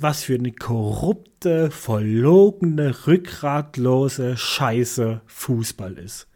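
each insult spelled out slowly; slow contemptuous. Real, not acted. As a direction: drag every word out with contempt.

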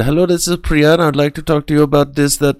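bright, quick Indian accent mimicking a caller. Imitating the Indian caller Priya's sales pitch in an accent. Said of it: "and I love that accent".

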